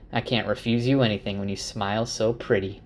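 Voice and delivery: ordinary delivery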